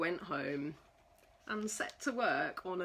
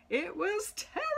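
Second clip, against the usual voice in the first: dramatic terror; 9 semitones higher, faster than usual. A narrator's reading. Narrator dramatizes scary moment.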